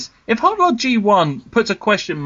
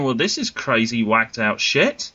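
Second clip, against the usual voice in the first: much lower puppet character voice; Button Moon character; 9 semitones lower than usual. Acted. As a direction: drop your pitch low for a kids' show character. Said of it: "characters from Button Moon saying".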